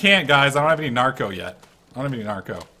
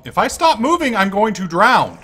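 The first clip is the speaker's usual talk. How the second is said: rising alarm, higher and louder; panicked; 8 semitones higher, 7 dB louder than usual. Real, not acted. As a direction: voice climbing as you sink. Drowning in game, panicking.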